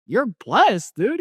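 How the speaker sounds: very high, thrilled exclamation; amazed excitement. Retold or imitated. Friend reacting to his vision, amazed and excited. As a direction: imitate a friend blown away.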